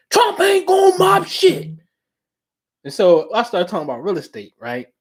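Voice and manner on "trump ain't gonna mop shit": high, scoffing voice for pops; father scoffing at Trump's chances, high mock voice; mocking reply as pops. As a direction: scoff as his father would. Pops answering the quote.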